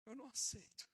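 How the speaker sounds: mock furious demand; retold angry protest. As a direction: push back hard at God. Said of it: "fiquei muito brabo e falei".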